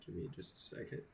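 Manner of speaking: ordinary talk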